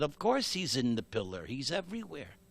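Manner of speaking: soft, matter-of-fact reply; calm assurance. A narrator's reading. Boy answering his threatening father, quietly certain. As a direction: answer softly, entirely sure.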